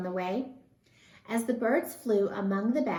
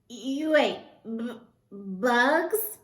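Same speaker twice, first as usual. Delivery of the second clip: stuttering; 3 semitones higher, 4 dB louder, much slower than usual. A narrator's reading. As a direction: trip over the word bugs in disbelief. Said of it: "stuttered one".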